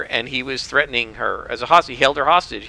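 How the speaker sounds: normal talking voice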